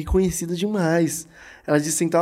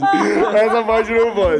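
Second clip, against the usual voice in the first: even slower, drawn-out laughing plea; slower laughing protest; 5 semitones higher, 5 dB louder, much slower than usual. Real, not acted. Repeating his plea to cut the story, laughing slower. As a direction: stretch the plea out through laughter.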